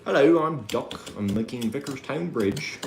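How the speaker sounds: lightly voiced Duck the engine; a hint of a toy engine character; Duck impression. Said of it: "Can you do a duck impression?".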